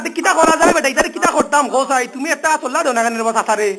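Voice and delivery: usual speaking voice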